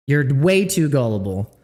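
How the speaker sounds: usual speaking voice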